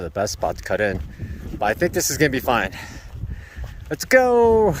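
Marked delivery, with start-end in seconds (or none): 3.9-4.7 high, loud and eager; excited. Real, not acted. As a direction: cheer it like setting off. Setting off on the trail.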